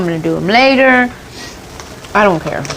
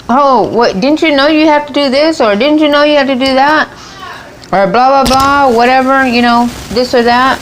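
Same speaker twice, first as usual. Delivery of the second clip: lecturing the TV; 7 semitones higher than usual. Retold or imitated. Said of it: "I'll talk to the T V".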